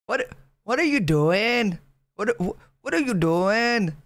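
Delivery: sing-song attempt at a Welsh accent. Shown as a demonstration. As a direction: lilt it like someone Welsh.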